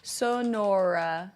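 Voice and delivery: Sonora said slowly, syllable by syllable; careful pronunciation. Shown as a demonstration. Streamer working out how to say Sonora, slowly. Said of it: "sn-, a Snora dog".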